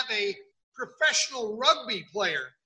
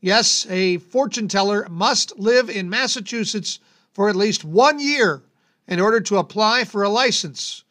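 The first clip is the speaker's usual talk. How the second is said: announcer read; 7 dB louder than usual. Acted. Reads bizarre-law segment over music.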